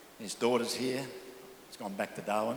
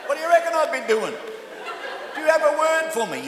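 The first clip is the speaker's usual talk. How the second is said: higher, louder exasperated retort; 8 semitones higher, 6 dB louder than usual. Retold or imitated. Pastor answering a three a.m. caller, exasperated retort. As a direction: throw the question back, fed up. Retold late night call reply.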